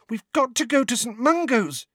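put-on high, pressing girl's plea; Ginny urges her brothers, high and insistent; urgent Ginny. A narrator's reading. Ginny urging her brothers to leave, high and pressing. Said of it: "said Ginny urgently".

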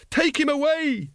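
high, hysterical screamed order; frenzied, very high yell. A narrator's reading. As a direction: shriek the command, drawing it out. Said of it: "screamed Once".

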